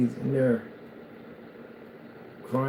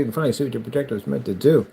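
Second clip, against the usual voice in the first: ordinary talk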